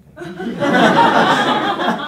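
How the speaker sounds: loud high burst of laughter; real loud laughing; big laugh. Real, not acted. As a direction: laugh it out hard. Laughing at own story.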